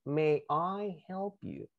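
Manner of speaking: "May I help you?" drawn out slowly for repeating; slow, careful model of "May I help you?"; pronunciation model. Shown as a demonstration. Teacher asks students to practise, slow pronunciation demo. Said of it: "Practíquenla".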